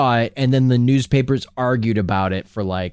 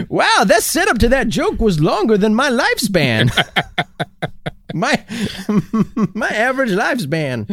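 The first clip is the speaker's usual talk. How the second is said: put-on high old heckler voice; hammed-up old heckler; old man voice; 7 semitones higher than usual.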